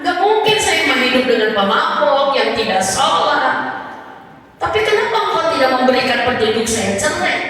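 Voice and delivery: high, loud pleading to God; imitating wife's anguished plea. Wife begging God for a sign, high pleading cry. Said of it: "dia mengatakan".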